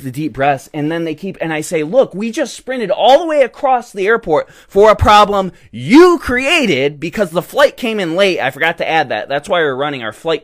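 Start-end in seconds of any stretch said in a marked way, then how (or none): 1.8-8.4 angry retort. Retold or imitated. Retold telling off flight attendant.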